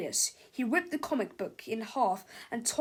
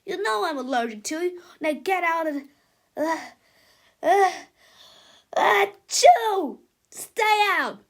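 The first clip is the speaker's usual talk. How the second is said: higher, louder scolding broken by a sneeze; angry sneezy rant; 6 semitones higher, 9 dB louder than usual. Acted. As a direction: shoo the cat while sneezing. Melvin yells at cat.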